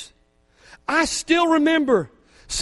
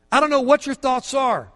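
usual speaking voice

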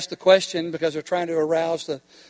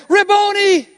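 high, loud cry of joy; Mary crying out to Jesus, high and loud; joyful recognition; 11 semitones higher, 7 dB louder than usual. Acted. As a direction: burst out with recognition.

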